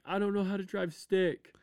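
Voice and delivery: high-pitched imitation of a distressed kid; teenager near tears. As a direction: imitate a teen's helpless confession. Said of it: "like, about to cry".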